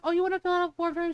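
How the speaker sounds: quick, high-pitched gush in imitation. Customer service girl praising a coworker, gushing. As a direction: imitate an eager admirer, racing along. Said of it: "gushing about it".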